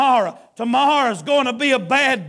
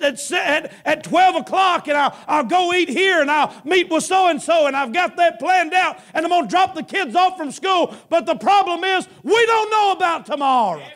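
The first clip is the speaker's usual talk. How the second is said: higher, driving revival preaching; preaching at full force; 5 semitones higher than usual. Acted. Preacher warning the congregation that tomorrow is coming, fervent. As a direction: build the sermon with urgency.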